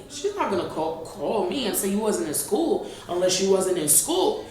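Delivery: usual speaking voice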